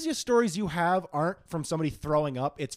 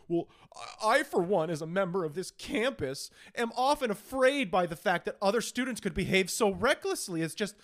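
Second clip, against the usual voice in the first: sanctimonious student; 8 semitones higher than usual. Acted. Student board member scolding an accused student, increasingly hysterical. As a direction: start primly concerned, climb into hysterical pleading.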